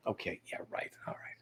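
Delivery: barely audible, voiceless aside; whispered reply. Acted. Tourist answering the safari guide, whispered very softly. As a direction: mumble it away under your breath.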